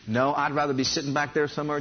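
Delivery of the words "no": ordinary talk, nothing marked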